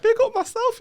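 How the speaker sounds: squeaky high, laughing self hype; giddy echo. Retold or imitated. Host recalls copying guest's catchphrase, giddy and laughing.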